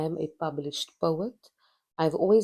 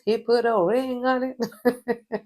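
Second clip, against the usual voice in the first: laughing; 7 semitones higher, 5 dB louder, slower than usual. Real, not acted. Bragging about her husband's ring.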